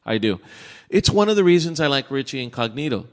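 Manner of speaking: usual speaking voice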